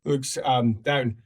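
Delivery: normal talking voice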